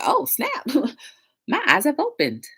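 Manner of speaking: high, amazed exclaim with a laugh; pretend revelation. Picturing someone's sudden insight, high amazed laugh. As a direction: gasp it like eyes just opened. Imagined epiphany.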